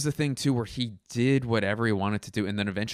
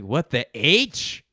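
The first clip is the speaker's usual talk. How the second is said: "What the H?" slow with the H leaned on, rising; odd emphasis; 3 semitones higher, 6 dB louder, slower than usual. Retold or imitated. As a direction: stretch "What the H?" and drive the H home. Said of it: "the emphasis that he would put on words".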